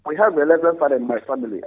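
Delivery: ordinary delivery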